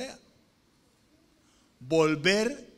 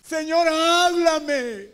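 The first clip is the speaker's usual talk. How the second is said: high, shouted, drawn-out plea; long wailing shout, begging for an answer; tearful cry to God; 12 semitones higher, 11 dB louder, much slower than usual. Acted. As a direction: cry out desperately in acted prayer.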